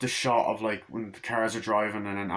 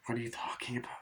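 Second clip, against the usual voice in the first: whispered, scared; 10 dB quieter, much faster than usual. Retold or imitated. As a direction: breathe it out in a whisper. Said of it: "It's like".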